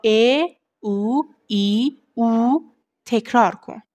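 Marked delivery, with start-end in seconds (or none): 0.0-2.6 each vowel ا، او، ای، او held long and separate; drawn-out vowels. Shown as a demonstration. Teacher listing vowels for the warm-up, ا، او، ای، او each drawn out. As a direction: stretch ا، او، ای، او as sustained open vowels. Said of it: "بعد همین رو با".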